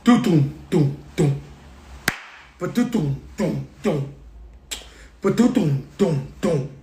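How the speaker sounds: beatboxed beat, low; beat imitation. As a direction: vocalize the bass beat of the song. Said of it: "plays the song".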